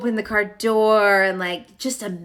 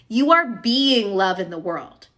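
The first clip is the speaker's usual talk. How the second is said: usual speaking voice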